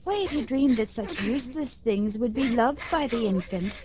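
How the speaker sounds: ordinary delivery, nothing marked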